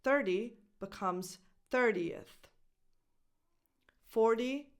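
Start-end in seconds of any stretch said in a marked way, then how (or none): 1.7-2.5 "thirtieth" in three syllables, thir-tee-uth; extra schwa. Shown as a demonstration. Teacher modelling the ordinal of thirty, "thirtieth" with extra schwa. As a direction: add a clear schwa before the TH in "thirtieth". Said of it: "a little extra sound, a little extra schwa".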